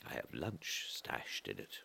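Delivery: ordinary delivery, nothing marked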